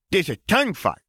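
put-on high little child voice; a small boy explaining earnestly; toddler voice. A narrator's reading. Toddler Ben explains the game.

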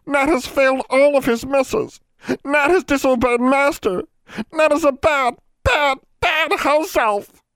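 very high, loud elf wail; piercing, distraught elf voice; wailing house elf. A narrator's reading. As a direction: wail in self-reproach as a frantic little creature. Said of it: "Nat wailed louder".

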